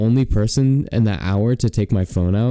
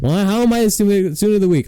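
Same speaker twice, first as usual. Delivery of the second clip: indignant protest; 9 semitones higher, faster than usual. Retold or imitated. Retold indignant protest to teacher.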